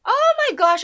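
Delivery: high, loud burst of delight; excited squeal. Real, not acted. Friend hears a favourite actor is cast, squeals loudly. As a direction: gasp it out, thrilled to hear his name. Excited at Daniel Dae Kim news.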